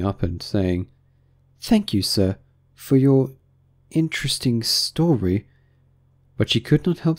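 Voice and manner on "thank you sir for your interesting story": higher, polite Alice voice. A narrator's reading. Alice about to thank the Mock Turtle, higher and polite. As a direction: lift the pitch for a courteous thanks.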